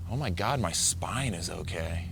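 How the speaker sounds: soft relieved inner voice; hushed relief. Retold or imitated. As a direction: breathe it out in quiet relief. Relief inner thought underwater.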